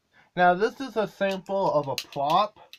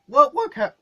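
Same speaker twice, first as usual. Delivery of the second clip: accidental squeak mid-word; a squeak slips out; squeaky voice crack; 9 semitones higher, 8 dB louder than usual. Real, not acted. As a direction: voice cracks higher and louder. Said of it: "I get squeaky voices sometimes".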